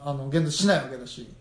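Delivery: normal talking voice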